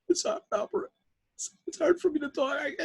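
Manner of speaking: high, broken imitation sobbing; crying. Taxi driver too upset about the operation to talk, crying. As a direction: choke the words out through mock tears. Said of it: "he starts to cry".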